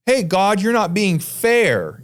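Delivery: high-pitched mock protest; imitated complaint, drawn out at the end; complaining to God. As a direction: object like a wronged student.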